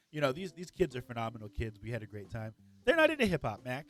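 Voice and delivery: ordinary talk, nothing marked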